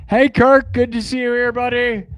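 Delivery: ordinary talk, nothing marked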